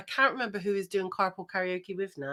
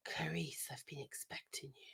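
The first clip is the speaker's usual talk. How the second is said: low whispered menace, much softer; sinister Hannibal Lecter; 5 semitones lower, 20 dB quieter than usual. Pretend. Friend tries a sinister Lecter whisper for the quiz. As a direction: breathe the line like a villain. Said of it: "Yeah, sinister".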